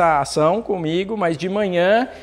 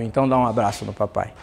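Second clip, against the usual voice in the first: fala terna e rápida com o filho; carinhoso, mais grave e baixo; 9 semitones lower, 6 dB quieter, faster than usual. Retold or imitated. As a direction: pede o abraço com doçura. Pai pede abraço ao filho. (pai pede abraço ao filho, com ternura)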